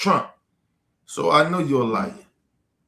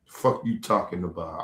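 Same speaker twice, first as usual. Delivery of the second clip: ordinary talk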